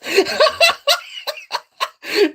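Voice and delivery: high, loud burst of laughing; real helpless belly laugh; uncontrollable laughter. Real, not acted. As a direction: laugh hard and long. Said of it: "I haven't laughed like this".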